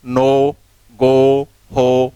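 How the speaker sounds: नो, गो, हो each said loud and drawn out; ओ sound demo. Shown as a demonstration. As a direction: hit the ओ in नो, गो, हो. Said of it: "ओ की ध्वनि आ रही है". (ओ ध्वनि का ज़ोरदार उच्चारण)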